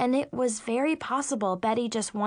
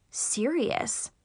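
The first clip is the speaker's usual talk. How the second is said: ordinary delivery, nothing marked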